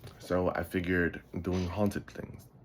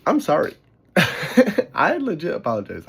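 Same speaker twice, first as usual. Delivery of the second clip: laughing apology, higher and louder; 8 semitones higher, 10 dB louder than usual. Real, not acted. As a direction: giggle through the apology.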